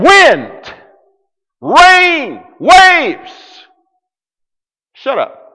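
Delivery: high, loud rising commands; commanding the storm. Retold or imitated. Jesus rebuking wind and waves, loud commands. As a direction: order the storm to stop.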